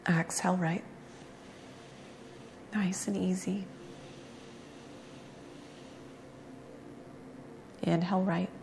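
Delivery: soft, unhurried yoga-teacher cues; guided breathing meditation. Real, not acted. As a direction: guide each breath quietly, with long pauses between cues.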